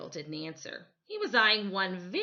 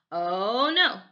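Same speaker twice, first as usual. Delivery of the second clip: scolding; 2 semitones higher, 8 dB louder than usual. Acted. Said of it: "their grandmother scolded".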